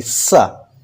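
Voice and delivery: सा with a thin stream of air at the teeth; dental sa. Shown as a demonstration. Teacher demonstrating the dental सा sound. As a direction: hiss सा with the tongue near the teeth. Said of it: "पतली सी हवा निकलेगी".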